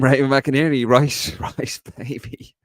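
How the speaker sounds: cracking up while reading it out; words dissolving into breathless laughter; laughing through words. Real, not acted. Host reading a viewer's rice pun, cracking up.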